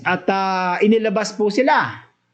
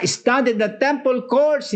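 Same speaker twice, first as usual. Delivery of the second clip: "Stand in the temple courts" raised high and insistent; emphatic command; 5 semitones higher than usual. Acted. Preacher voicing the angel's order to the apostles, emphatic. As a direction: press the order as a bold command. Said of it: "Sabi niya".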